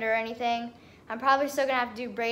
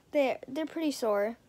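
ordinary talk

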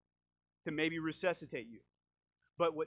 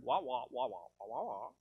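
low droning wah-wah like muffled adult talk; 4 semitones lower than usual. Retold or imitated. Husband tuning out his wife, droning wah wah. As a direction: mimic a voice you're tuning out. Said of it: "I just heard".

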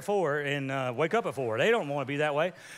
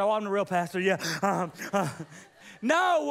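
ordinary talk

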